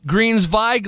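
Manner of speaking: Greensweig with the W said as a V. Shown as a demonstration. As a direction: say Greensweig as "Greens-vig", German-style. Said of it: "the W is pronounced as a V".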